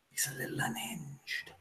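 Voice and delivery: hushed aside whisper. Acted. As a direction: lean in and whisper it. Bartender confides about the old man.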